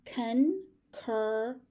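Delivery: "Concurrent" very slowly, in separate syllables. Shown as a demonstration. Teacher breaking a word down for learners, syllable by syllable. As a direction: break "Concurrent" into three parts with pauses.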